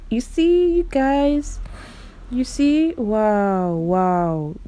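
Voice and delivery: high, delighted "you see"; excited. Real, not acted. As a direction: squeal it out to your viewers in delight. Excited showing hair.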